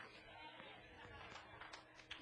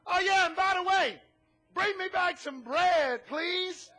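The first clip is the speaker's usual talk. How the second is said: a mock call back over the shoulder, a bit louder; yelling back; 6 semitones higher, 6 dB louder than usual. Elisha calling after the widow, a mild mock yell. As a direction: call after her as she walks off. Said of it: "he yells back".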